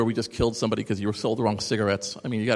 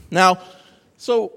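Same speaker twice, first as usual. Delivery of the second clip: normal talking voice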